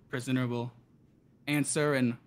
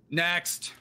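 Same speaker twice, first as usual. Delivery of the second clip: a sharp called-out next; yells; 5 semitones higher than usual. Acted. Clerk dismissing the orc, called out. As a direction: bark the call down the hall. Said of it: "and he just yells".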